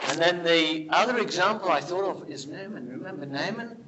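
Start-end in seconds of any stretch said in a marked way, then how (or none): none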